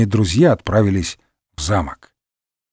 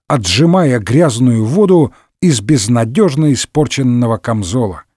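ordinary delivery, nothing marked

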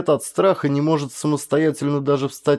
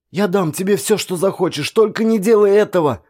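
much higher, louder desperate babble; pleading in panic; 7 semitones higher, 4 dB louder than usual. A narrator's reading. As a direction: rattle off the plea in fear. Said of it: "затараторил вор". (вор в панике тараторит мольбу, высоко и громко)

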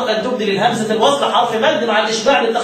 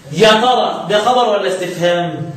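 usual speaking voice

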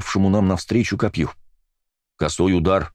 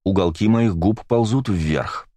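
ordinary delivery, nothing marked

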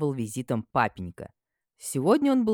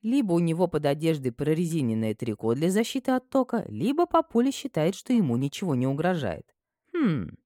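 ordinary delivery

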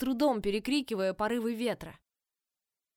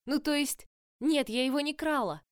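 higher, nervous flustered denial; 6 semitones higher than usual. A narrator's reading. Flustered girl denying.

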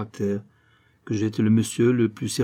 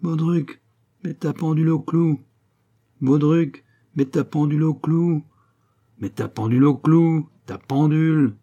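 raised, monotonous pestering chant; relentless singsong nagging, held on a higher note; droning nag; 6 semitones higher, 4 dB louder than usual. A narrator's reading. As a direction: keep repeating it in a doleful drone. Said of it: "en lamentable faux-bourdon".

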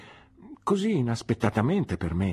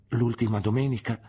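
ordinary delivery

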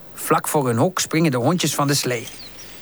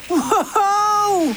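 excited shout. Acted. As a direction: cry out with thrill. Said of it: "roepen ze".

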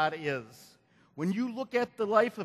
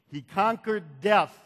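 usual speaking voice

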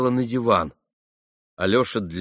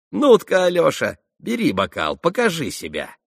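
high, loud jeering voice; sneering young man, loud and high; mocking Rakitin; 9 semitones higher, 4 dB louder than usual. A narrator's reading. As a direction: tease her loudly, almost yelling.

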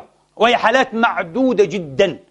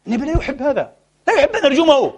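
ordinary delivery

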